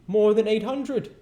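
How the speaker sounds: high, loud grand announcement; hearty ghost announcing. Acted. Ghost introduces himself to Scrooge, high and loud. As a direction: proclaim yourself like a jolly giant. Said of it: "said the ghost".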